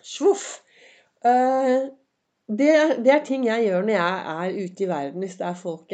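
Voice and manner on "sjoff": high whoosh. Shown as a demonstration. Repeats the whoosh higher.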